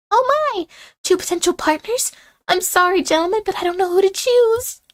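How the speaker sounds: high, flustered schoolgirl; gasp, then fret sweetly; high girly voice. Acted. Miriam torn between two partners.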